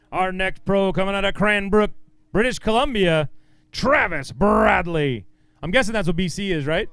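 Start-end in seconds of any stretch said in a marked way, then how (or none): 0.1-5.3 big announcer intro with the name drawn out slowly; stage announcer. Real, not acted. Emcee introduces the next pro from Canada, announcer style. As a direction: roll out the name for the crowd.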